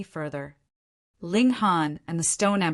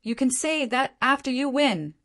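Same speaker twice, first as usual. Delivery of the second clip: stern retort, pitched high; 7 semitones higher than usual. A narrator's reading. Stone Emperor before the next exchange of blows, stern. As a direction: cut him off firmly, voice raised in pitch. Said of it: "said sternly".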